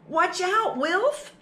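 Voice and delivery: high, loud warning call; mom calling a warning. Acted. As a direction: call out to stop the little mouse. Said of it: "said Mom".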